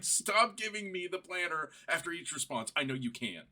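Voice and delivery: loud, high-pitched exasperated yell; getting mad. Retold or imitated. Fed-up user telling the AI to stop, mad yelling. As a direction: yell it in comic fury, voice shooting up. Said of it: "So I'm getting mad".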